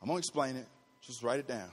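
hushed and low; low aside. Real, not acted. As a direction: drop to a quiet aside for note-takers. Low aside to note-takers.

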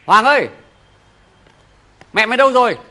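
loud call to his son from afar. Acted. Father calls his son Hoàng, loud calling out. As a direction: raise the voice to reach the boy in the other room.

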